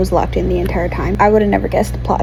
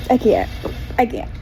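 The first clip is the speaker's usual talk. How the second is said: ordinary delivery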